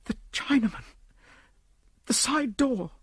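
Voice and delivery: high, urgent gasped cry; a wounded woman crying out; distressed lady. Acted. Injured lady cries out.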